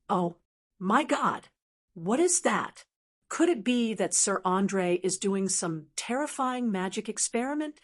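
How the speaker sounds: usual speaking voice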